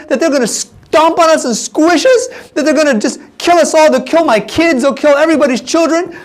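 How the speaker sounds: high-pitched, worked-up disbelief; incredulous protest. Retold or imitated. Spy aghast at Caleb's plan, acted incredulous fretting. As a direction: let the pitch climb as the fears pile up.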